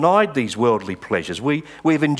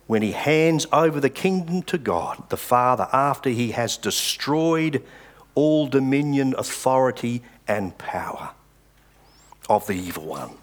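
scripture reading. Acted. Reads verse twenty-four.

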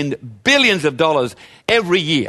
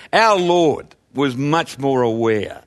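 ordinary talk, nothing marked

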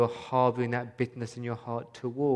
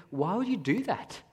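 higher, mock disbelief stressing you; incredulous thought; 8 semitones higher than usual. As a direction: voice the baffled inner question. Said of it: "I'm thinking".

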